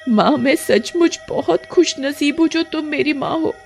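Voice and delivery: put-on high, heartfelt girl's voice; grateful young daughter. Daughter thanking her adoptive witch mother, heartfelt.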